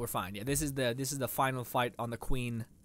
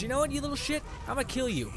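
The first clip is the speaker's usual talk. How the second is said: higher pitched taunting threat; mock threat; 9 semitones higher, faster than usual. Real, not acted. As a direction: threaten the little enemy with glee. Threatening enemy animal.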